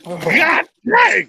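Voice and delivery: loud gasping exclamation; pained shout. Real, not acted. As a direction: shout it after a harsh gulp. After chugging the drink.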